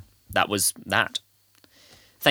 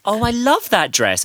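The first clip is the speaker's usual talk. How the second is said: fake-sweet compliment; 11 semitones higher, 4 dB louder, slower than usual. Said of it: "Facebook is one that's like".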